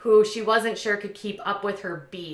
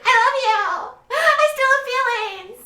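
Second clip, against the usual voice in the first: hammed-up lovesick outburst; mock weepy declaration; 7 dB louder than usual. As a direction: squeal it like an overwrought ex. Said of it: "then in the next breath, she's like".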